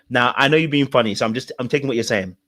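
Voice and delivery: ordinary delivery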